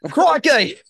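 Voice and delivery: loud, high mock Australian cry; hammed-up Australian exclamation; Australian accent. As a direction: burst out with a caricature Australian shout. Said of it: "And now they're all".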